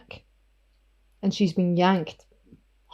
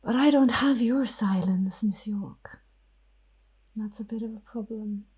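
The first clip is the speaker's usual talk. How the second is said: hushed, menacing vampire whisper; whispering vampire Ophelia; 4 semitones higher than usual. Acted. Ophelia the vampire threatens Miss York, whispering.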